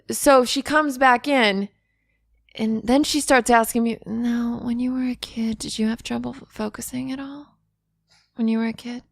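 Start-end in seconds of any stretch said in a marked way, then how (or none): 4.1-9.0 soft, slightly raised questioning voice; softly voiced retold question; doctor's gentle questioning. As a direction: imitate a doctor probing quietly.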